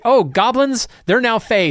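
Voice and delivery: high, sarcastic "oh" of a smug excuse; mock designer voice. Mocking the rulebook writers' goblin excuse, high and sarcastic. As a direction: toss it off like an easy fix.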